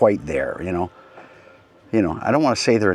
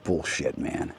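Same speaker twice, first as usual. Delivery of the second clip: muttered thought; 5 semitones lower, 11 dB quieter, slower than usual. Retold or imitated. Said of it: "And I thought".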